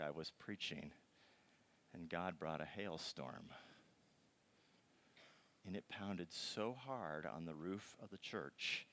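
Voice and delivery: ordinary talk, nothing marked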